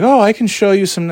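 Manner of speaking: high, eager offer; mock eager saleswoman. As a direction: chirp the offer brightly. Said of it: "she's like".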